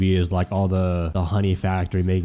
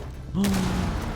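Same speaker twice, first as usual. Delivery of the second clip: sharp gasp; 10 semitones higher, 5 dB quieter than usual. Real, not acted. Sudden discovery in game.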